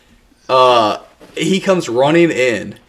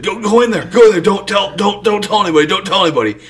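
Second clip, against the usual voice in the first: imitated urgent hushing-up; 5 semitones higher, faster than usual. Coach hustling injured player away, retold.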